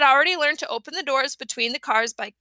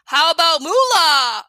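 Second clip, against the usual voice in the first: shouted joke; 3 semitones higher, 6 dB louder than usual. A narrator's reading. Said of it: "shouted out".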